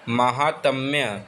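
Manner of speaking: usual speaking voice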